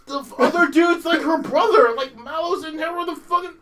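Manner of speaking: loud, high exasperated shouting; outraged shout. Real, not acted. Gamer shouting about the plot to a friend, loud and high. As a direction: yell it in total disbelief. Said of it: "ranting in disbelief".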